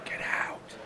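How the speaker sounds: harsh angry whisper; whisper yelling. Retold or imitated. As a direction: hiss it out angrily. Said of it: "It's like an angry whisper".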